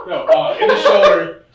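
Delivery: loud laughing blurt. Real, not acted.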